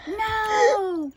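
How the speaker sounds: loud, high refusal; crowd yell. A narrator's reading. Crowd refuses being eagle's lunch, yelling. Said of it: "the crowd yelled".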